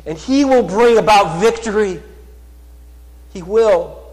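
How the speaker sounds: loud, high, rising sermon voice, slowing on key words; fervent pulpit proclamation; full-force preaching. Acted. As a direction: preach it out to the whole church. Preaching promise of victory.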